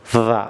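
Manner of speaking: "the" said short as thuh before consonants; correct the. Shown as a demonstration. Teacher models "the" before consonants, short thuh. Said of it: "রোল one".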